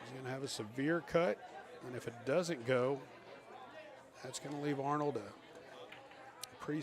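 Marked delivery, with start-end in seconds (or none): none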